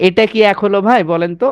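ordinary talk

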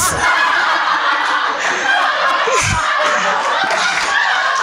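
shrieking high laugh at the punchline; high squealing laugh. Real, not acted. (tawa melengking tinggi)

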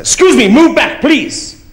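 mock Dutch driver telling the other car to move; bossy driver. Dutch driver insisting again.

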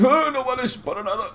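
high-pitched mock jeer; mocking hecklers. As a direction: imitate jeering viewers in a high voice. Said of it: "La gente va a decir".

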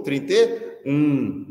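very slow, deliberate; slow drilled repeat. Retold or imitated. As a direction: drag it out like a drill. Group chant of number. (todos repetem juntos o número, bem devagar)

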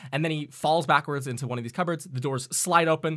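ordinary talk, nothing marked

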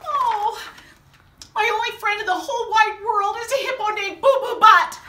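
high, loud whiny reading; comic exasperation. Acted. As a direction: read it like it's torture.

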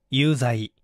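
usual speaking voice

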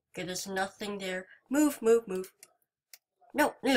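normal talking voice

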